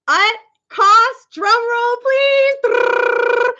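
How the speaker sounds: high, drawn-up game-show build-up; playful suspenseful announcement. Pretend. Streamer revealing the shirt's price to viewers, playfully building suspense. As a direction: pitch it high and hold for the reveal.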